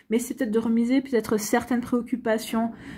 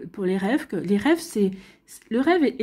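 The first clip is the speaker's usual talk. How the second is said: ordinary talk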